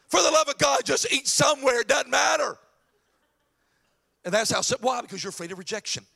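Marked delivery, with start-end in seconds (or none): 0.1-2.6 raised, higher voice, fed up with the dithering; loud, impatient outburst; exasperated shout. Acted. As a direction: cut in loudly with comic exasperation. Exasperated retort to acted ditherer.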